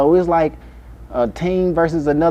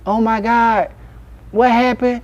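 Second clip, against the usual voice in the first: mother voiced; 8 semitones higher, slower than usual. Mimicking flirtatious mother.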